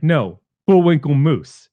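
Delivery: slow, mildly mocking comeback; sarcastic detective. Movie detective answering at the crime scene, imitated mild sarcasm. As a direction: draw it out with light sarcasm.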